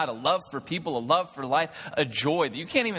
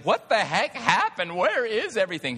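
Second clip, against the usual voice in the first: bewildered; 9 semitones higher, 4 dB louder than usual. Retold or imitated. Waking to find joy gone.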